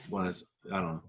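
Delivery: ordinary delivery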